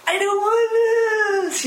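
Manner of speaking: mock tantrum cry; tantrum whine. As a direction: whine like a toddler on the floor. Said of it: "we throw ourselves down on the floor and we go".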